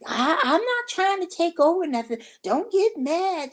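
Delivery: squeaky high protest, slowing on the last words; mock pleading. Retelling how she pleaded with Martha not to get mad. Mimicking her own pleading.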